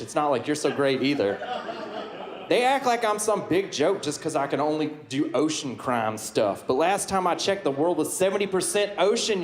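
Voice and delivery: put-on folksy Southern accent, teasing the crowd. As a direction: play a good-ol'-boy Southern Aquaman. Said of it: "why do you have a southern accent?".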